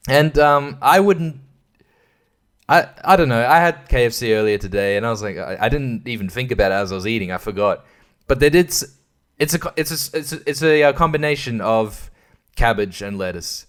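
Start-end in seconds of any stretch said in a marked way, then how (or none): none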